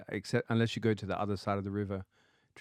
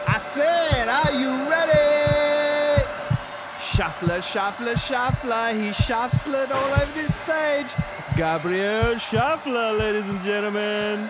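loud, high, drawn-out arena shout; hype man; 11 semitones higher, 7 dB louder, slower than usual. Pretend. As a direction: hype the crowd like an MC. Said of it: "I'm gonna be your hype man".

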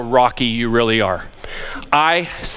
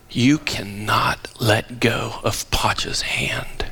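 whisper; 5 semitones lower, 4 dB quieter, much slower than usual. Retold or imitated. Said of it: "I would say to my grandson".

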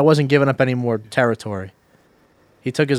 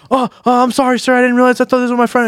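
high, louder, flustered mock apology; panicked apology; 11 semitones higher, 5 dB louder, faster than usual. Imitating stranger backing down to dad, panicked and high. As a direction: blurt out excuses in a pushed-up high voice.

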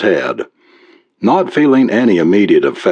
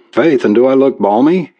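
higher-pitched Irish lilt; Irish brogue; 5 semitones higher than usual. A narrator's reading. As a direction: balk in a light Irish brogue. Irish cop refuses the alien's cap.

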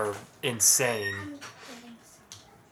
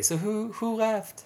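higher, lightly voiced curious wife; wife asking; 9 semitones higher, 4 dB quieter, slower than usual. Wife asking next morning who left the show, lightly voiced high question. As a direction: ask it like the wife wanting to know. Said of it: "she asked me the next day".

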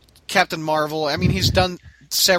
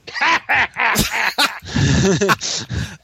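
gleeful cackling goblin laugh; goblin cackle; 4 semitones higher than usual. Real, not acted. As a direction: cackle like a giggly little goblin. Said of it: "he brought out the goblin cackle".

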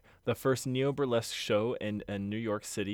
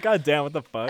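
loud amazed; 5 semitones higher, 10 dB louder than usual. Retold or imitated. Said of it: "You're like".

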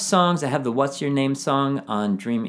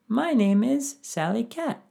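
much higher, slowish cute cat voice; cat puppet; 11 semitones higher than usual. Pretend. Teacher voicing a cat puppet answering the dog, much higher and cute. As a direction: squeak it out like a little puppet cat. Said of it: "Maybe a dog and a cat".

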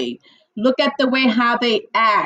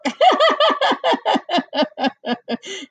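high giggle burst; high laugh; 10 semitones higher than usual. Real, not acted. Laughs at own joke.

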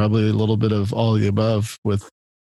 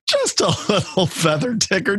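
laughing; 9 semitones higher than usual. Real, not acted. Laughing at tickling joke.

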